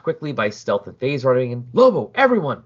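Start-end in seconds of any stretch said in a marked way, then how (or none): 1.7-2.6 higher, louder urgent call. Acted. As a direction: burst in shouting for everyone. Bursting in, calling out.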